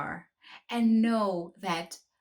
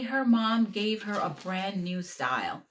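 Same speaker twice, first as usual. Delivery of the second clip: usual speaking voice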